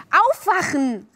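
high, loud wake-up call; excited shout to wake them. A narrator's reading. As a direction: rouse the sleepers urgently. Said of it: "rief sie".

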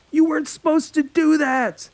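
high, loud accusing yell; teen boy yelling in panic. Acted. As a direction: shout it at him in alarm. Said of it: "Alex yelled at the mortician".

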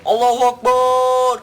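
very high, very loud, slow war shout; drawn-out battle cry. Acted. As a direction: stretch it out as a roaring charge cry. Charging into battle.

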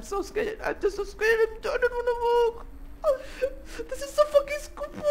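high, whiny mock fear; mock scared whine. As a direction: whimper like a frightened child. Scared whining in dark.

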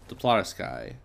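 normal talking voice

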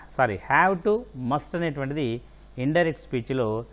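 ordinary delivery

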